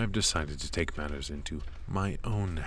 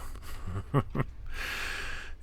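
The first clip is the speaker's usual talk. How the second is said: a quiet, unvoiced little laugh; soft chuckle; 3 semitones higher, 6 dB quieter than usual. Tutor amused by the apprentice's answer, soft chuckle.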